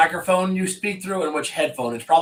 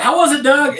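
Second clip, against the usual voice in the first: eager question; 10 semitones higher, 6 dB louder than usual. Retold or imitated. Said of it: "I was like".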